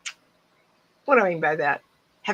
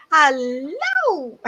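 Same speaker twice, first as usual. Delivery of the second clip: higher, loud greeting cry; loud hello; 9 semitones higher, 3 dB louder than usual. Retold or imitated. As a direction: yell it across the room to a friend. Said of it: "someone's going".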